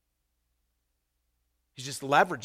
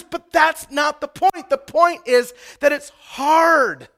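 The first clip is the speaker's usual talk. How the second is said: louder, higher sermon voice building to shouts; full-force preaching; 12 semitones higher, 15 dB louder than usual. Acted. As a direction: hammer home the call to make disciples. Preaching the call to disciple.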